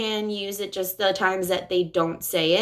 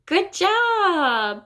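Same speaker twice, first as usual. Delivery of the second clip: much higher, bright "Good job"; cheerful praise; 9 semitones higher than usual. Shown as a demonstration. Therapist praising a child's request. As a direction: cheer warmly.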